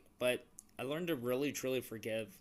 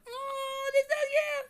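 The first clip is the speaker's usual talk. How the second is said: very high squealing mock cry; mock wail; 6 dB louder, much slower than usual. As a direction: squeal it in fake dismay. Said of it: "she's like".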